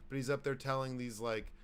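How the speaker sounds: ordinary talk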